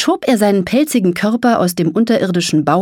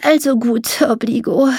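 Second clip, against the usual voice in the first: higher-pitched resigned sigh; 5 semitones higher than usual. A narrator's reading. Mole gives in to the dragon, sighing. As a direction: sigh it out, giving in. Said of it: "der Maulwurf seufzte".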